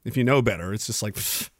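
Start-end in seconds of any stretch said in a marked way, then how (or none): none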